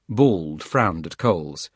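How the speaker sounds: ordinary delivery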